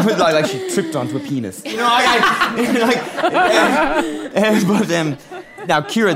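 talking through real laughter; laughing. Real, not acted. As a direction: laugh while you speak. Laughing at her own joke.

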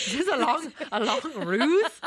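laughing, high and loud, in mock alarm; mock paranoid. Teasing Laura about a plot to ruin her, laughing high. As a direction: giggle through a fake accusation.

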